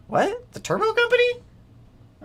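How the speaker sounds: very high-pitched, disbelieving question; squeaky double take; incredulous surprise. Real, not acted. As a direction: shoot way up in pitch, astonished. Startled by an unlikely sponsor claim.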